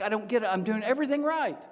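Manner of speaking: high, exasperated complaint; mock-plaintive, pitched high; frustrated bewilderment. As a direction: protest upward, acting out John's confusion. Voicing John's frustrated bewilderment.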